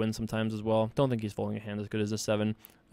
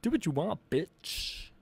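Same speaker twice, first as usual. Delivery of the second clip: nerd voice; 7 semitones higher, 5 dB quieter, slower than usual. Pretend. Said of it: "Too much nerd voice. I was getting too angry".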